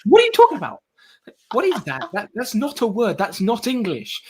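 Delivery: high, incredulous what are you talking about; mock bafflement. Friend mocking a mate's new words, baffled and high. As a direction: play it like a baffled friend who can't follow the slang. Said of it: "I'm like".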